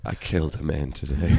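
low, soft, heavy-hearted; understated tragic whisper-like line; mock tragic confession. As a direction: say it like a broken soldier.

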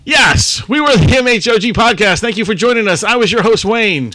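loud, high hosting outro voice; announcer sign-off. Real, not acted. Host signing off the podcast to listeners, in an announcer voice. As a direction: wrap up the show like a radio host. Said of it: "podcast sign-off outro".

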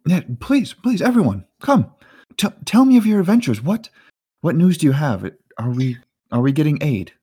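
high-pitched, pressing questions; eager Gundis. Acted. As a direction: usher guests in with a high, eager voice. Gundis eagerly asks for news.

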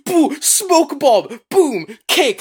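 high, punchy play-by-play booms; action sound effects. Retold or imitated. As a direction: act out the fight in high bursts.

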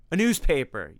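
loud triumphant yell; yelled answer. Acted. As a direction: shout the punchline at him. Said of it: "yelled Tim".

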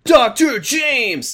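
slow, high-pitched dramatic call; melodramatic announcement. Acted. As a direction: draw out the name slowly, high and grand. Dramatic intro of new character.

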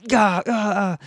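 high, choked "gah" stammer; imitated flustered noise. Garrett reacts as a car pulls away, mock sputtering. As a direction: sputter high wordless syllables, mimicking him. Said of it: "And Garrett does this".

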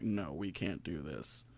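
very low, quiet and slow; muttered aside. Retold or imitated. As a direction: drop it to a hushed murmur. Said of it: "they were like".

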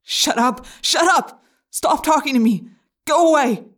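loud, high panicked shouting; screaming. Acted. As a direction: drown the voice out. Jake screams at the voice.

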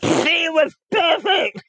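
laughing while shouting it. Real, not acted. Laughing shout about disguise.

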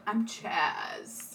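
low, soft, put-on cool voice; would-be cool introduction. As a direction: drop it low and quiet for the cool alias. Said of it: "then he takes off his glasses".

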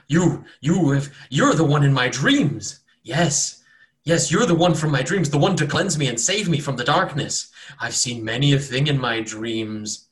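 he's found his savior; awed cultist. Acted. Cultist on the floor awed at the cleric from his dreams.

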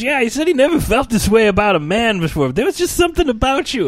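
very high, loud sing-song tease; teasing mock-swoon. Booth guy teasing the boss, high swooning voice. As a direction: croon it like a love confession. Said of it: "he goes".

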